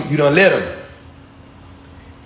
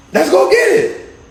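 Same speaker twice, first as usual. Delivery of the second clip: higher, slower, pumped-up repeat; rally yourself to go; hyped-up Joshua; 11 semitones higher than usual. Retold or imitated. Joshua answering God, quoted.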